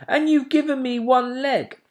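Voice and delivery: put-on high, loud childish grievance; complaining owlet. A narrator's reading. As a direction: point out the missing leg, outraged. Plop complaining.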